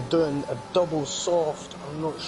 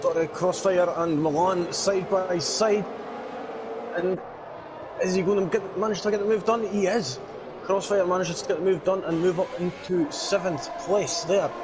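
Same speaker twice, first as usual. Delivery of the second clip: raised, driving race call; motorsport commentator; 4 semitones higher than usual. Acted. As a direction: call the battle live, on the edge. Live race play-by-play.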